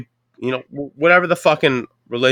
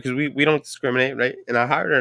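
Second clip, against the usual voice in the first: usual speaking voice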